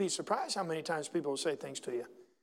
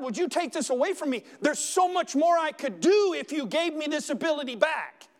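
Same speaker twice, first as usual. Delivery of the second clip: high, louder, impassioned plea; pleading with God; 7 semitones higher, 4 dB louder than usual. Acted. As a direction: cry out to God for healing.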